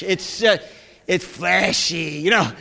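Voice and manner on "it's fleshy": fleshy said low and very slow; low, dragged-out "fleshy"; drawn-out FLESHY. Shown as a demonstration. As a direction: stretch the first syllable of fleshy, pitched low. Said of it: "Say it like this".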